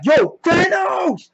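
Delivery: high, slow, drawn-out yell; mock battle shout. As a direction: holler the name, stretching it out. Acting out Drax's angry call.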